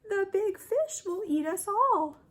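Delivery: little red fish. A narrator's reading. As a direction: squeak it like a tiny frightened fish. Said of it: "said the little red fish".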